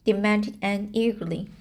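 usual speaking voice

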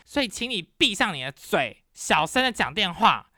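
loud, fast, furious rant, replayed for the listener; retold angry tirade. Yelling at the loud roommate about his phone call, furious rant. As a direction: let the scolding pour out, high and hard. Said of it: "我就立刻破口大骂".

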